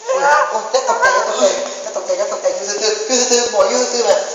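panicked laughing plea. Real, not acted. Panics at game monster.

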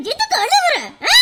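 high, loud repeat of the scold; angry shout. Acted.

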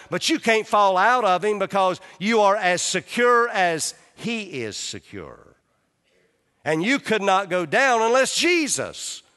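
full-voiced pulpit preaching. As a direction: preach it out with building force. Preaching on security in Christ.

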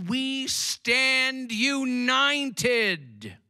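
slow, loud, high shout of unity. Real, not acted. Preacher rallying the congregation, slow loud shout. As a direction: stretch it out and shout it. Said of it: "I needed a bigger shout than that".

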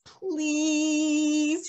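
high drawn-out pleading; pleading toddler. Retold or imitated. Grandson begging for snacks, high pleading. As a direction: beg with a rising childish please. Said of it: "he growls".

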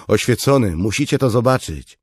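high, loud summons; calling out. Acted. Soldier summons his commander to see something, calling out. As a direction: call the commander over urgently.

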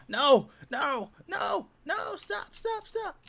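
high squeaky pleading no no stop; frantic high-pitched begging; panicked squeal. Real, not acted. Villager escaping, pleading it to stop.

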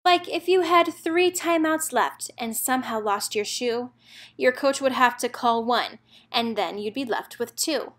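plain reading voice

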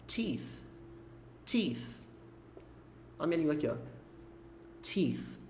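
"Teeth" softly, ending in th with the tongue out; tongue between teeth. Shown as a demonstration. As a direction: push the tongue tip out on the end of "Teeth". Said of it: "tem o T H no final". ("teeth" com a língua entre os dentes)

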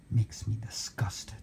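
sigh, then a barely audible whisper; very soft, defeated whisper; whispered disgust. Real, not acted. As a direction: whisper it under your breath in disgust. Disgusted aside after the rant.

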